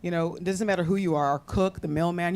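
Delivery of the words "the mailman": usual speaking voice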